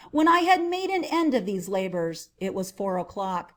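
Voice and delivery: ordinary delivery, nothing marked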